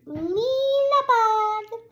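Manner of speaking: squeaky high, loud Bug; funny high voice. Real, not acted. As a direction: pop the word up high. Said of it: "I don't know why I say it like that".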